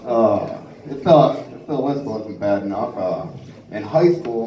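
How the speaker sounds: usual speaking voice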